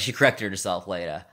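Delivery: ordinary talk, nothing marked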